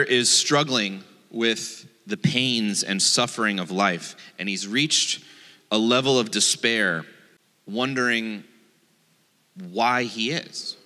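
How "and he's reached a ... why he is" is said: normal talking voice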